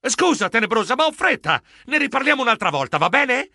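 flustered, high-pitched excuse; high yelp. Acted. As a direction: cut the call short in a rush. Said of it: "Io strillai".

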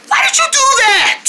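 very high shouted reproach; outraged shriek. Acted. Scolds after failed bounce.

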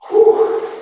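high breathy whew of relief; tired whew. Real, not acted. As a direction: blow out hard after a set. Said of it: "Feeling that one".

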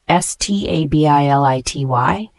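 normal talking voice

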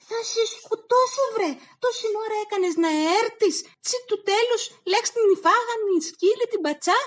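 high, loud, fast raging mother with a sob in it; furious threat. Acted. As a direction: yell at the useless son. Η μάνα απειλεί τον γιο. (έξαλλη μάνα φωνάζει ψηλά και γρήγορα)

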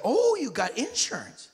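sudden high, loud "oh" of a greedy doctor; mock delight at the insurance card; excited doctor. Doctor spotting the insurance, suddenly high and loud.

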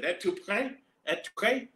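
"Êtes-tu prêt?" asked twice with a rising tune; chirpy anglophone "Êtes-tu prêt?"; clumsy French. Retold or imitated. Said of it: "I said".

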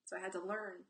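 ordinary talk, nothing marked

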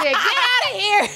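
high, loud, laughing shout; mock dismissal. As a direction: throw them out in a drawn-out yell through laughter. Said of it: "and they said".

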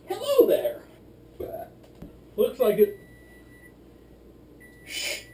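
ordinary delivery

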